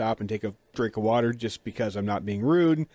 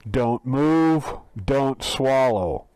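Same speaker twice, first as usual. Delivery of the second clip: loud, distorted machine command; robotic loudspeaker yelling orders; electronic voice; 4 dB louder, much slower than usual. Retold or imitated. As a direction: bark it like an overmodulated speaker. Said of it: "this electronic voice yells at you".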